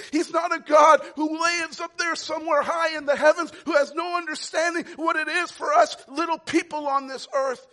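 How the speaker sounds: high, loud shouted sermon; fiery preacher shouting the truth; full-force preaching. Real, not acted. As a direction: proclaim it to the back row.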